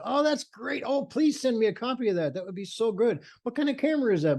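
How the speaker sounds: high-pitched gushing admiration; delighted parents. Retold or imitated. Parents seeing photos of their children, mock gushing.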